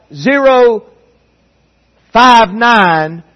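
long pause splitting the number; delayed call-out. Acted. Recruit asleep at roll call, hesitant delayed count. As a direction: call it late, with a big gap. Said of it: "who's sleeping. He's not paying attention".

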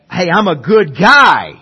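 mock boast. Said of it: "that was that".

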